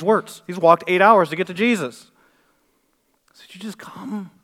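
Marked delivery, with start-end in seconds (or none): 3.3-4.3 whisper. Retold or imitated. Father's desperate plea retold.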